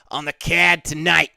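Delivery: attempted Boston accent with flattened vowels; amateur Boston accent, fight-announcer line. Shown as a demonstration. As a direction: have a shaky go at Boston. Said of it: "Do a Boston accent".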